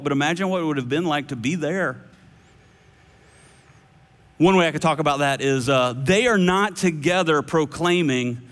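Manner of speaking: ordinary talk, nothing marked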